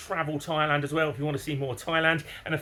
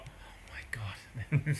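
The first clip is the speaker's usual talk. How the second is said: whispered disbelief; 10 dB quieter, much slower than usual. Real, not acted. Exasperated at staff runaround.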